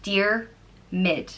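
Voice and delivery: Diarmid split slowly as "Dear" then "Mid"; syllable by syllable. Shown as a demonstration. Teaching a learner the name, split into syllables. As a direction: sound out Dear, pause, Mid. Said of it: "it's pronounced".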